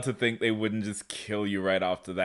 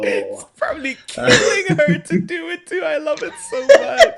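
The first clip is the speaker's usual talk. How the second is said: excited squeal; 5 dB louder than usual. Real, not acted. Excited fan reaction.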